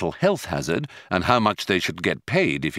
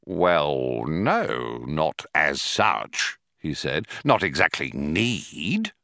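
slow, lower, faltering foreman; lower hesitant official; 5 semitones lower, much slower than usual. A narrator's reading. Prosser stalling before Ford, low hesitant voice. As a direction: hedge each word, unsure.

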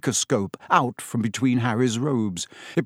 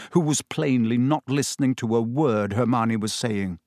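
plain reading voice

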